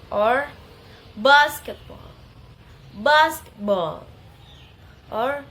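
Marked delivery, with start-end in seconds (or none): 1.1-4.0 "basketball" with BAS loud; stress on first syllable. Shown as a demonstration. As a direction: punch BAS in "basketball", secondary weight on BALL. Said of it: "primary stress in the first syllable".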